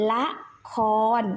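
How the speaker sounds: ละคร read ละ-คอน, short then long; two separated syllables, ละ then คอน with an ออ vowel; pronunciation demo. Shown as a demonstration. Teacher reads ละคร for pupils, careful syllables. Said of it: "อ่านว่า".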